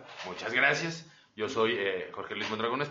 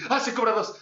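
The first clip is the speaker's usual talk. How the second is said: high, louder startled waking cry; startled waking line; 9 semitones higher, 5 dB louder than usual. Retold or imitated. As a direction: jolt awake in surprise. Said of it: "que despiertes así".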